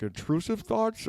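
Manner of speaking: high questioning pitch; surprised question. Retold or imitated. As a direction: ask it high and puzzled. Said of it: "I'm like".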